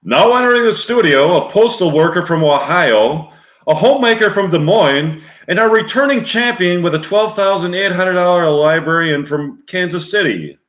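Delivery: loud, high-energy studio announcer; game show announcer. Acted. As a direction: project it like a TV show introduction. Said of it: "Deep voice a-, announcer".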